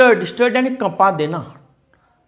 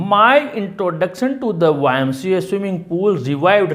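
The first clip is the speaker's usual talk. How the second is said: slow reading aloud; much slower than usual. Shown as a demonstration. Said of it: "teacher reads text line slowly".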